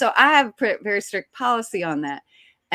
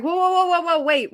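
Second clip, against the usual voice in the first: high, louder string of protests; retold alarm, pitched up to stop everything; alarmed halt; 9 semitones higher, 4 dB louder than usual. Actress skimming new lines and stopping the director, alarmed.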